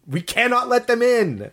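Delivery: high, slow insistent warning; drawn-out, emphatic plea rising in pitch; Ripley. Acted. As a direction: impersonate an exasperated woman laying down the rule. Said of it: "Ripley when she's like".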